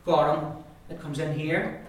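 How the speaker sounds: ordinary talk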